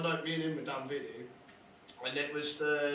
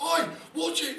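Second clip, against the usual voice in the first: mock aggressive oi; 12 semitones higher, 5 dB louder than usual. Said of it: "he was like".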